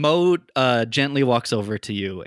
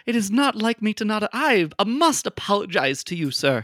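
higher, politely sheepish voice; apologetic character; 8 semitones higher, 3 dB louder than usual. Acted. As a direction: apologize with fussy courtesy.

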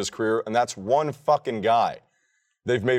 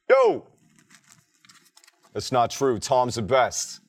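put-on rough stab at a Boston accent; bad masshole accent; 3 semitones higher, slower than usual. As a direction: attempt a Masshole heckle. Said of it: "I can't do a good masshole accent".

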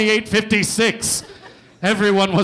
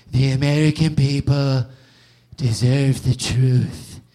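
mock solemn Trump; 4 semitones lower, slower than usual. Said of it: "gets on T V one day and goes".

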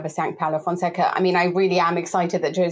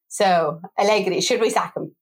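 ordinary delivery

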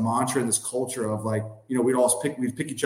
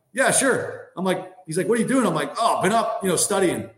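high, bright act of being wide awake; faked wide awake; 6 semitones higher than usual. Player on the phone with his coach at dawn, faking being wide awake, high. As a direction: pretend you've been up for hours.

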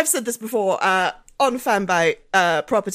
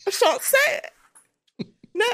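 laughing mock British refusal; 11 semitones higher than usual. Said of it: "what's a quick British way to say cigarettes".

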